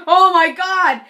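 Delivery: high, loud panicked cry; hammed-up shocked exclamation; mock horror. Hurt girl told of bloody ice, mock horror.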